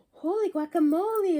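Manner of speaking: loud, high startled cry; shocked kitten yelling at the mirror; exclaiming. Acted. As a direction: shout it in amazement. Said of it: "exclaimed Skippy John Jones".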